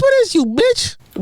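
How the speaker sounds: high, drawn-out disbelieving question; affronted, stretched-out demand; incredulous outrage. Real, not acted. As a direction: pitch it high in indignant disbelief. Incredulous rant at played clip.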